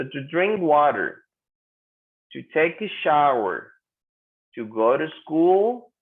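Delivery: slow, drawn-out "drink water", "take a shower", "go to school"; pronunciation model. Shown as a demonstration. Teacher tells students to practise pronunciation, slow careful phrase-by-phrase model. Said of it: "You need to practice the pronunciation".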